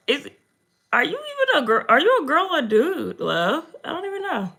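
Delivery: usual speaking voice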